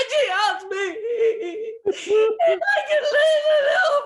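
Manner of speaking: loud, very high comic bawling; hammed-up wailing. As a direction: bawl it out, dragging into a wail. Re-enacting sobbing to partner.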